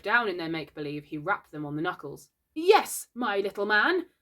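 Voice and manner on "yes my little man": mildly anxious pretend doctor; nervously playing the grown-up; anxious reply. A narrator's reading. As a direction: start high and loud, then settle. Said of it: "Slightly anxiously replied".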